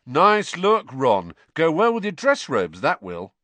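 teasing. A narrator's reading. Fred teases Ron loudly.

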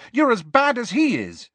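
high-pitched angry accusation; shouted. A narrator's reading. Lily rounds on James, angrily shouting. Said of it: "Lily shouted".

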